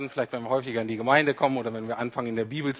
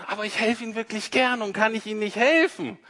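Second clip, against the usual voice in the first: a lightly voiced pushy nice stranger, higher; sweetly insisting stranger offering help; overeager helper; 6 semitones higher than usual. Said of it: "diese nette Person".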